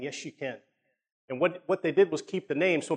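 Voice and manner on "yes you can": 1 quiet reassurance. Retold or imitated. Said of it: "But Dad's saying".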